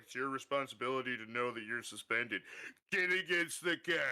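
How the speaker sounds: put-on officer voice rising to a bark; lecture, then bark the order; imitated cop voice. Officer lecturing suspended driver before cuffing him, mock cop voice. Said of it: "Is that what he sounded like?".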